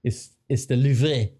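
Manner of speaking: "Louvre" drawn out in a mock French accent; imitating a French "Louvre"; slow mock French "Louvre". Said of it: "Gundogan's like".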